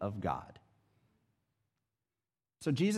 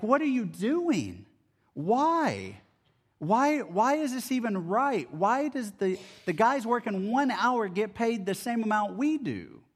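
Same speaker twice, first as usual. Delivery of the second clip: higher, indignant mock complaint; mad workers; 9 semitones higher than usual. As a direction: protest the unfair pay like a grumbling worker. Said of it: "They're mad".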